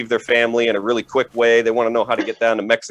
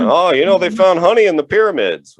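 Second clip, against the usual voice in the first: dad voice; 9 semitones higher, 5 dB louder than usual. Retold or imitated. Dad quoted in standup bit.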